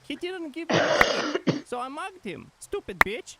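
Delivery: character voice. Acted. Mugging player, role voice.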